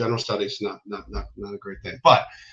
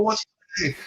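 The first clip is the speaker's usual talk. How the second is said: high-pitched sergeant demanding an answer; mock drill sergeant yell; 12 semitones higher than usual. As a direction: imitate a drill sergeant quizzing a recruit. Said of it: "He's yelling at everybody".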